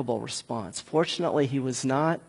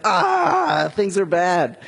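a sudden high wail sinking into mock gloom; mock-tragic wail over bad news; mock despair; 4 semitones higher, 4 dB louder, much slower than usual. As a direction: ham up a fan's despair. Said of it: "you would, like, tear your clothes".

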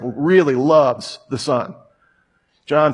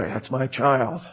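tender father; 2 semitones lower, 5 dB quieter than usual. Acted. God thinking fondly of his child, softly. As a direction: murmur it like a loving father. God's tender thoughts about you.

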